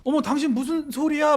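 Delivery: high, awkward fake innocence; awkward feigned surprise. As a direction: pretend you know nothing, clumsily. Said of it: "막 이렇게 어색하게". (높은 목소리로 어색하게 시치미 떼기)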